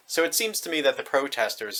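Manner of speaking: usual speaking voice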